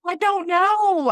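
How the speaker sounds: high, drawn-out imitated wail; mock crying. Answering the coach from the crying chair, mock crying. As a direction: wail it slowly, acting out the ugly cry. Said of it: "the ugly cry".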